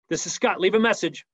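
curt, forceful voicemail greeting; bullet-point delivery, short and emphatic; fiery red voicemail. Shown as a demonstration. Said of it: "Fiery red. Leave bullet points".

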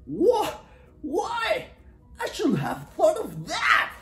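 very high, loud exclamations; astonished excitement. A narrator's reading. Dingo hears Echidna's idea, excited. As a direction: burst out in a squeal of realisation.

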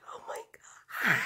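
slow, airy whispered disbelief; blowing air instead of screaming. Real, not acted. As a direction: whisper it on a long gush of breath. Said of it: "blow air out of my mouth".

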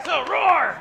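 loud, high roar; teacher's roar demo. Shown as a demonstration. Teacher showing the class a bigger lion roar. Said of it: "let's give him a big, lot more energy".

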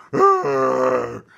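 very loud growling bear roar; a huge roar right over the lamb. Acted. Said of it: "and he went".

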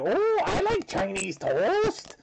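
high, slow, put-on Chinese accent imitation; mock Chinese accent. Imitating Brittany's toast joke in a mock Chinese accent. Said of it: "stereotypical racist Chinese voice".

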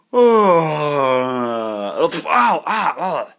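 long low moan into short ows; pained groan. Pretend. Playing a hurt bird in a story, pained groans. Injured bird moaning in pain.

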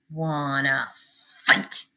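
low, slow challenge; grouchy ladybug voice. Acted. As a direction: drag out wanna fight.

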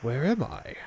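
ordinary talk

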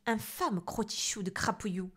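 loud, snarling witch rant; witch scolding. Acted. Witch Cornebidouille berating the boy, loud rant. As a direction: spit out the insults like a cranky witch.

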